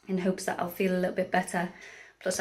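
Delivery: normal talking voice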